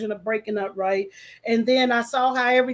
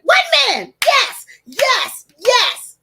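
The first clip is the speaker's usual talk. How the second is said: excited cheer; 10 semitones higher, 8 dB louder, much slower than usual. Pretend. Cheering her hoped-for ally.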